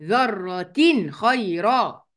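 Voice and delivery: ذرة خيرا with the noon sounded clearly before خ, slowly; slow tajweed recitation of ذرة خيرا, clear noon; clear izhar. Shown as a demonstration. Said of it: "هقولها كده".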